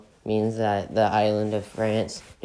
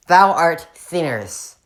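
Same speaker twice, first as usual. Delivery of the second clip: hammed-up preacher, higher, louder and slow; lightly acted saint scolding the Scots; mock preacher; 5 semitones higher, 6 dB louder, slower than usual. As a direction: boom it like an old saint condemning sinners.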